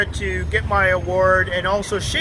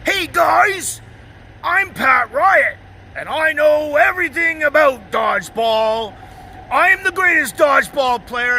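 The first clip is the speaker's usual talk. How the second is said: hammed-up loudmouth bragging to the dodgeball team; cocky braggart impression; 3 semitones higher than usual. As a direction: brag at the team like you know everything. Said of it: "he says to everybody on the team".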